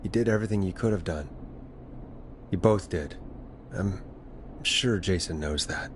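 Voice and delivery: low, subdued consoling voice; gentle reassurance. Acted. Host reassures guilty caller about Jason, gentle. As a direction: comfort the mourner, pausing softly.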